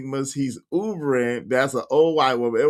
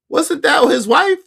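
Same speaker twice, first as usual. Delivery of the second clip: higher, slow unsure question; surprised doubt; 5 dB louder than usual. Real, not acted. Host second-guessing a chat comment, surprised. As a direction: catch yourself mid-doubt. Realizing mistake about Daryl's wife.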